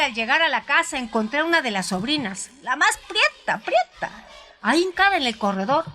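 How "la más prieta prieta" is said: ordinary delivery, nothing marked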